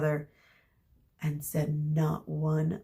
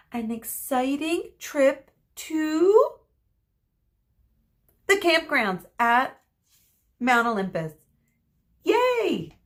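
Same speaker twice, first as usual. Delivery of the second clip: mock cheery announcement; 8 semitones higher, 6 dB louder than usual. A narrator's reading. Teacher teasingly announcing the dull trip, high and loud. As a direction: sell the bad news as a treat.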